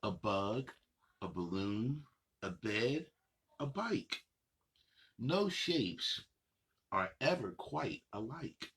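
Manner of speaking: sing-song picture book reading, unhurried pauses; reading to children. Acted. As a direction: read the rhymes to little kids, savoring each line. Reads picture book aloud.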